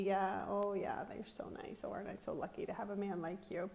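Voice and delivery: hushed mock-grateful retort; sarcastic whisper. Retelling her sarcastic whispered reply to her husband. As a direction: whisper it back with dripping sarcasm.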